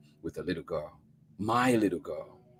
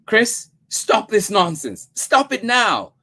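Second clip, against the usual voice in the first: high, loud, slow stern command; mother scolding a weeping child; sharp scolding; 11 dB louder than usual. A narrator's reading. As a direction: snap at the child to stop. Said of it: "I struck her sharply".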